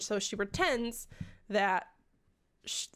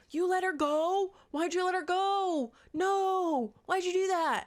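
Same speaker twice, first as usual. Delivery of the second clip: mock outrage; 8 semitones higher than usual. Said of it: "everyone's basically like".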